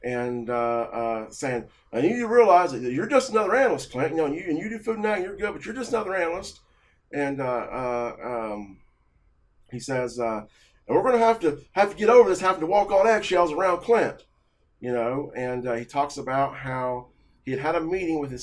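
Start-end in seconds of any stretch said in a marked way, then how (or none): 1.9-6.6 high, loud scolding that loses steam at the end; boss going off. Retold or imitated. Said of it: "going off on me". 10.9-14.2 much higher, much louder mock yell; angry boss yelling. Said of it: "going off on me".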